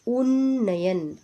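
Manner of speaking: slow उन्नयन with a long held double n; syllable demo. Shown as a demonstration. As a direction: stretch उन्नयन into "unn-na-yan". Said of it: "उन्नयन सही है ये".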